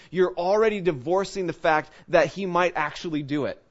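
normal talking voice

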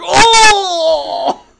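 long, loud, high wail; hammed-up cry after a whack; mock pained howl. Son hit on forehead by dad's ring, mock howl. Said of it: "And I'd be like".

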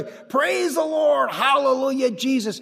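high, drawn-out holler easing downward; exuberant praise shout. Retold or imitated. Resident praising at the dining table, exuberant shout. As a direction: imitate unembarrassed shouting of praise.

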